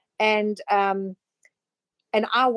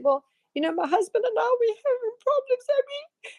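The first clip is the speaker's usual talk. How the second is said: much higher mock woman's voice; mimicked woman; 14 semitones higher than usual. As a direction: squeak it up as the troubled wife. Woman retold in Tony Robbins story.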